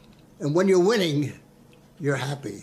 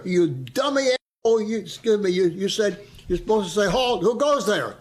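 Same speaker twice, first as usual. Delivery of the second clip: scolding sergeant; 5 semitones higher than usual. Retold or imitated. Sergeant scolding the new private.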